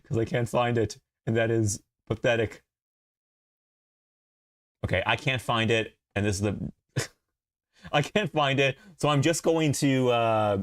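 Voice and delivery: ordinary delivery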